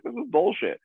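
high-pitched mild outrage; retold indignation. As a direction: protest it, pitched well up. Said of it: "I was just angry".

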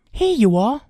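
put-on high, loud boyish offer; helpful boy. A narrator's reading. Boy handing the old man his staff, helpful. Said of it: "he offered".

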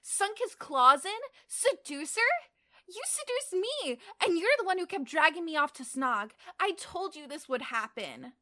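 loud, high-pitched mock outrage; accusing, worked-up complaint; indignant cry. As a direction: protest hotly, voice shooting up. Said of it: "Teddy cried".

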